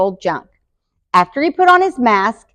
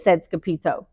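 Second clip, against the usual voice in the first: ordinary delivery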